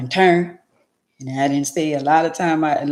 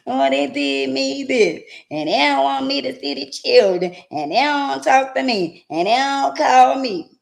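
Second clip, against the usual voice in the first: mock whining parent; 9 semitones higher, 4 dB louder than usual.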